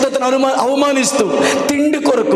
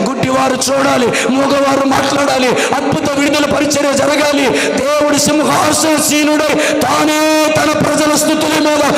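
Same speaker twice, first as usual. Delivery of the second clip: fervent preaching; 4 semitones higher than usual. Acted. Builds prayer to full force.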